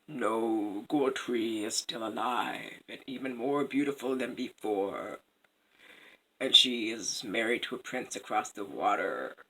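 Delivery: low, soft fish voice; hushed, deep oracle fish; unruffled fish. Acted. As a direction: answer low and quietly, unmoved. Fish replies to furious queen.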